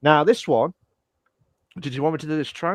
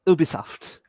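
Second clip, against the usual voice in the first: ordinary delivery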